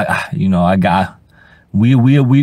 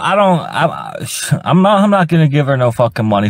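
normal talking voice

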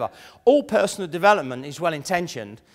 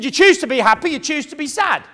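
higher, louder, preachy slogan; mock guru proclamation; 8 semitones higher, 5 dB louder than usual. Speaker mocking a personal development guru, proclaiming loudly. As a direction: lightly mimic a self-help guru's big claim. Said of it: "He said".

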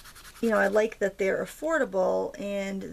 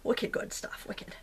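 thick Maine accent with dropped Rs; slower than usual. Shown as a demonstration. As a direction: say it like a local from Maine. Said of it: "I'm from Maine, in case you can't tell".

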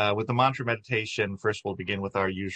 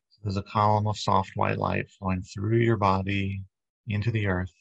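guided meditation. Acted. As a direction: ease the congregation into calm, unhurried. Leads a guided body-light meditation.

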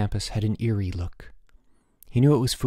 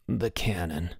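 strangled voice; 5 semitones lower, 7 dB quieter, slower than usual. A narrator's reading. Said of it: "said Bruno in a strangled voice".

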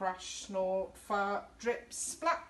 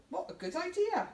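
high, eager little monster; a hint of a cheerful monster pal; lightly voiced monster friend; 10 semitones higher than usual. A narrator's reading. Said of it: "says his best friend Burpy".

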